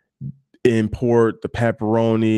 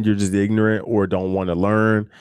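usual speaking voice

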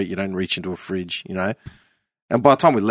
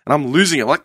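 ordinary talk, nothing marked

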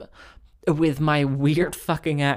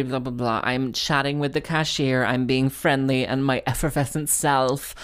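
ordinary talk, nothing marked

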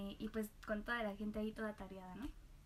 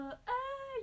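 high yelp of distress; a squeak of pure stress; stressed panic. Retold or imitated. Employee caught by her boss, high stressed yelp. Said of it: "súper estresada".

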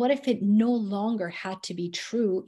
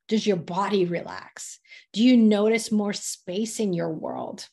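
ordinary talk

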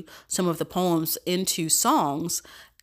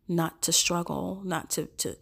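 usual speaking voice

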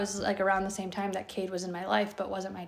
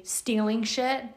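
normal talking voice

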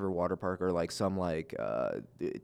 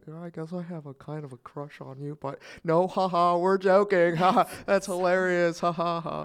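mock joking crush; 8 semitones higher, 5 dB louder than usual.

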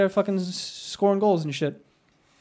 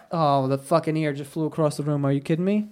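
usual speaking voice

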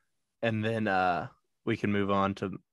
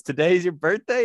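higher, louder surprised question; Smokey surprised; 6 semitones higher, 5 dB louder than usual. Retold or imitated. Friend quoting Smokey's birthday surprise, higher and louder. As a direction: fake shock at the birthday. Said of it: "Smokey goes".

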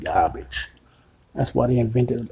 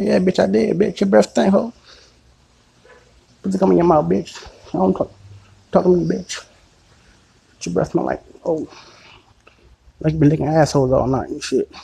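higher, louder defiant retort; retold loud retort; 6 semitones higher, 6 dB louder than usual. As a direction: fire back at her, loud and high. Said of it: "I'm like".

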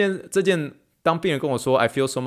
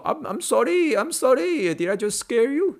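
much higher, anxious flurry of sorrys; apologetic Indian patient; 14 semitones higher, slower than usual. Retold or imitated. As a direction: imitate a worried patient apologizing. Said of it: "他就都会说".

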